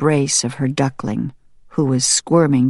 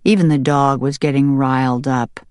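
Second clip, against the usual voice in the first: ordinary delivery, nothing marked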